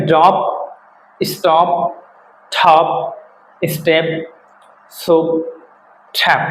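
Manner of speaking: ড্রপ, স্টপ, থপ, each ending in a plain p; word list with pauses, ড্রপ to ট্র্যাপ closing on p without a puff; unaspirated final p. Shown as a demonstration. Teacher drilling examples, ড্রপ and the rest ending in unaspirated p. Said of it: "সুতরাং এটা প হবে".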